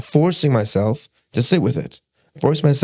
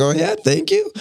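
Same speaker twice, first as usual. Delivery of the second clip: smug mock thanks. Mocking the kid's smug reply.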